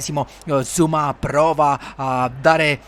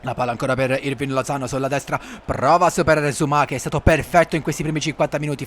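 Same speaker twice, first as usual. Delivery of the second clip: ordinary delivery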